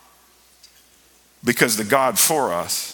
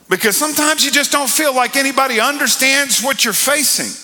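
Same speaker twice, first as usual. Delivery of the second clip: high-pitched, impassioned preaching; 13 semitones higher, 4 dB louder than usual. Real, not acted. Pastor speaking to those who feel misunderstood, preaching fervently. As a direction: push the voice high with fervour.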